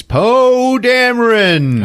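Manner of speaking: loud, high, drawn-out name announcement; excited reveal. Real, not acted. Revealing who the husband is, excited and stretched out. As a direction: announce the star with a big flourish.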